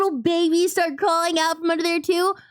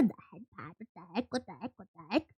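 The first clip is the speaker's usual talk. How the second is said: soft repeated what the heck; muttering; 8 semitones lower, 15 dB quieter, much faster than usual. Real, not acted. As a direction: mutter it under your breath. Muttering at stream.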